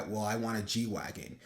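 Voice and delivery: ordinary talk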